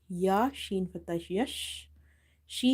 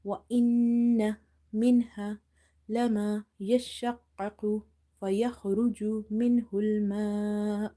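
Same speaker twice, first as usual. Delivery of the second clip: Quran recitation; 4 dB louder, much faster than usual. Shown as a demonstration. Reads the verse one more time.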